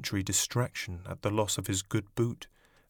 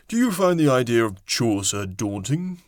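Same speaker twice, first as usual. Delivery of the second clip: interviewer voice; 6 dB louder than usual. A narrator's reading.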